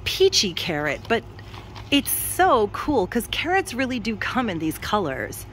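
ordinary talk, nothing marked

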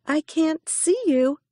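high, puzzled little hare; young hare voice. A narrator's reading. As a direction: voice a small curious rabbit. Hare speaks in story.

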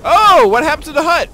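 sudden high alarmed cry; shocked, shouting out in alarm; startled shout. Real, not acted. Sudden alarm at burning hut.

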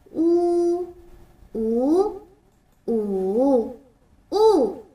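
呜 said level, rising, dipping, falling; 呜 each tone drawn out for kids; four tones of wu. Shown as a demonstration. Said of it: "再跟我读一遍吧".

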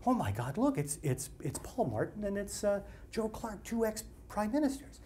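imitating an amazed woman in the street; astonished passer-by. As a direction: spot celebrities and gasp it discreetly. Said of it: "this woman stops next to me and she goes".

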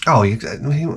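ordinary talk, nothing marked

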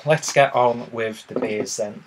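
ordinary talk

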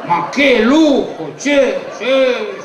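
slow, stretched mock admiration; drawn-out marvelling. Guest raving about the party, drawn-out admiration. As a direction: savour each word in exaggerated awe.

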